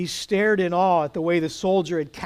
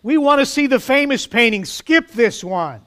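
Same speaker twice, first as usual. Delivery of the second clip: higher, louder heckle from the back; impatient shout; 6 semitones higher, 5 dB louder than usual. A narrator's reading. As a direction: shout it out over the room. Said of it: "shouted out".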